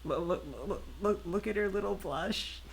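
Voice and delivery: high, stuttering squee of delight; delighted gushing. Real, not acted. Viewer pointing out a character's little blush, delighted gushing. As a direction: coo excitedly, tripping over the first words.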